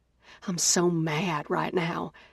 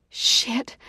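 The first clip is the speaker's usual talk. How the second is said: usual speaking voice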